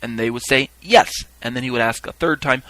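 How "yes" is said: high, loud one-word answer; crowd's shout. Retold or imitated. Witnesses answering the reaper, shouting. Acted crowd reply in ritual.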